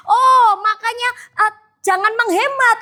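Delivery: high and cracking with emotion; tearful realization. Real, not acted. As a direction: let the voice break as it dawns on you. Emotional realization about the verse.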